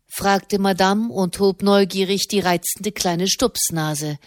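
ordinary delivery

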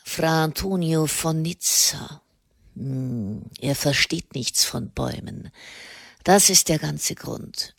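subdued, halting, soft-edged voice; musing half to himself, with hesitations; lightly voiced reluctant hermit. A narrator's reading. Hermit explains his solitude to Katharina, musing.

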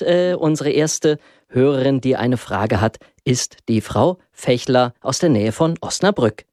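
ordinary talk

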